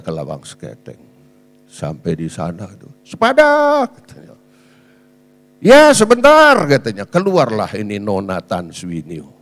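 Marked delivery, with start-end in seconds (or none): 3.1-3.9 seruan bertamu bernada tinggi; seruan memanggil. Retold or imitated. Meniru orang memanggil di depan rumah. 5.6-6.6 keras dan tinggi menyahut tamu; seruan menjawab. Retold or imitated. Meniru jawaban berseru dari dalam rumah.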